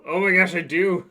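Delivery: loud, high, thrilled surprise; excited shout. Real, not acted. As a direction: blurt it out with delight. Finds the old camera.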